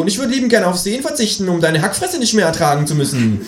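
high, fast kangaroo sneer; cheeky kangaroo voice. Acted. Kangaroo trading insults with the narrator, high and quick. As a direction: jab back quickly.

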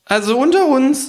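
hohe, laute Figurenstimme; hoch und laut ins Vertrauen ziehen; Känguru. Acted. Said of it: "sagt das Känguru". (hohe Känguru-Stimme)

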